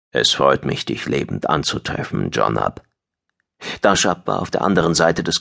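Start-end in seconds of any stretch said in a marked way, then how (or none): 0.1-2.8 alien friend's voice. A narrator's reading. Alien to his captive friend, low formal greeting.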